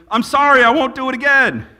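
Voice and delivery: put-on child's sing-song sorry to mom and dad; kid apologizing to parents. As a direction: plead like a caught child. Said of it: "at my parents and go".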